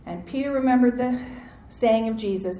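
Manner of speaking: ordinary talk, nothing marked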